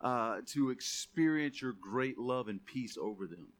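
low, hushed prayer to close. Real, not acted.

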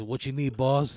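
put-on thick Italian accent, lightly done; a tough Italian guy asking the boss. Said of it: "a real thick Italian accent".

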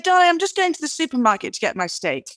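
high, quick breezy mother's brush-off; mother tossing off a casual reply. Retold or imitated. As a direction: rattle it off lightly like a busy mum. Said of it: "And she said".

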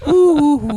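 high, loud camp ooh; camp squeal. Pretend. Camp Irishman squealing ooh, high. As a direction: squeal it playfully.

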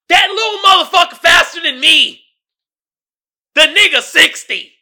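amazed shout. Real, not acted. To his viewers, stunned that Katt outran him, shouting. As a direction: yell it out, blown away. Amazed at Katt's forty-yard time.

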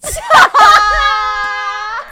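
a burst of high, loud laughter; real laugh thrown out at the absurd lyrics; loud shrieking laugh. Real, not acted. Friend hears the strange lyric and shrieks with laughter.

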